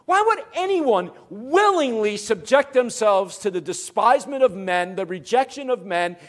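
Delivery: high, loud, incredulous pulpit questioning; forceful preaching with heavily weighted phrases; impassioned preaching. Real, not acted. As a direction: cry out the question to the congregation.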